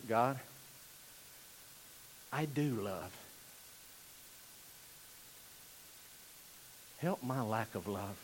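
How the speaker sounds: low, soft, with long pauses; hushed confession. Shown as a demonstration. Preacher leading the room in confession, hushed. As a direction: confess it quietly, leaving silence. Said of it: "saying this confession to God".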